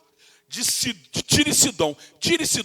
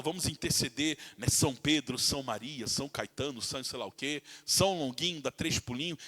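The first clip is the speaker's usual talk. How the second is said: mocking mimicry; 8 semitones lower, 10 dB quieter than usual. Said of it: "Aí os católicos falam".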